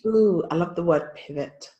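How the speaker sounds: ordinary delivery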